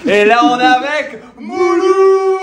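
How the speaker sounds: excited announcement. Real, not acted. Announcing the guest.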